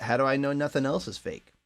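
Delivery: ordinary delivery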